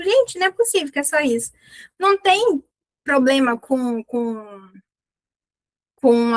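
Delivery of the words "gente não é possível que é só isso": mock disbelief. Mock disbelief at easy modals.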